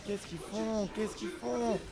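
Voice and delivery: rising, climbing disbelief. Real, not acted.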